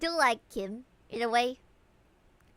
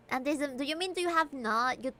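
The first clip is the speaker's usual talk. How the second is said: usual speaking voice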